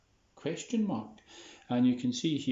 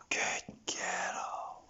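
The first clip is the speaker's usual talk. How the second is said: whispered praise; 5 dB quieter than usual. Real, not acted. Owner praising her dog Molly, soft whisper. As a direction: hush the praise to a calm dog.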